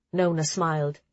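usual speaking voice

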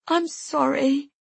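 slow, high, sobbing apology. Acted. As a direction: sob the words out. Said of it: "I sob".

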